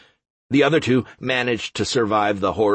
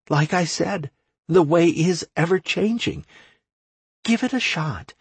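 put-on higher, eager young woman's voice; 6 semitones higher than usual. A narrator's reading. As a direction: urge him on, bright and higher. Anastasia voiced in story.

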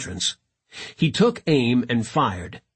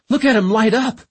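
raised, higher-pitched battle cry; excited shout; 9 semitones higher, 5 dB louder than usual. A narrator's reading. Gilmore to the crew as she starts shooting, shouting. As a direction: call it out over the gunfire. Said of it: "Gilmore shouted".

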